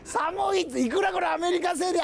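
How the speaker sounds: high, laughing shout about the cold; шутливое возмущение холодом. Real, not acted. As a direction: protest the cold in a high, amused yell. Complaining of cold while waiting.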